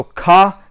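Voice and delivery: "car" as kah, no R; British CAR. Shown as a demonstration. Recapping for viewers, "car" without its R. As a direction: leave the R off "car". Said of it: "Car becomes ka".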